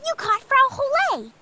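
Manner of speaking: excited cheer. Acted. Cheering the caught cow.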